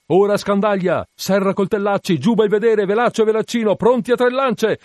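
very high, loud commands; captain's urgent yell, voice pitched right up; shouted orders. A narrator's reading. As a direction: rattle off orders to the whole ship.

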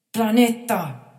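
loud high desperate call; boy insisting; insistent plea. A narrator's reading. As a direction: cry out his name. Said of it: "insiste il ragazzo".

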